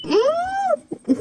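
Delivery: high, drawn-out hmm; a drawn-out curious hum over a bottle; long curious hum. Acted. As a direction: sniff and wonder aloud. Sniffing the bottle.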